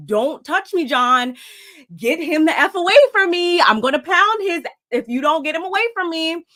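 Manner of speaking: hammed-up threat of a man who feels threatened; imitating a furious yell. Husband warning John off, a mock angry shout. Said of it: "he's like, you know".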